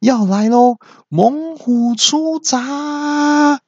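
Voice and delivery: very high, slow, drawn-out war cry; hammed-up, very high kung-fu yell; battle cry. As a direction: announce the tiger move theatrically. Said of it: "四弟大喊".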